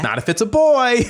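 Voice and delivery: high-pitched mimicked kid's objection; imitating young child. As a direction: pipe up like a six-year-old. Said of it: "But Kendall goes".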